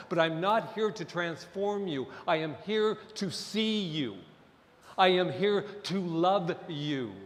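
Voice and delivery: normal talking voice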